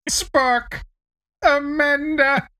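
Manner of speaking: performing the Sarek line on request; Sarek impression. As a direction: drag out each word like Sarek breaking down. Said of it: "come on, you can do it".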